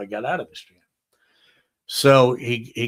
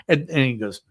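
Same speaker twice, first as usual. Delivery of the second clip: ordinary delivery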